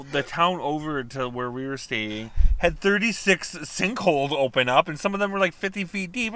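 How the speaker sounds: ordinary delivery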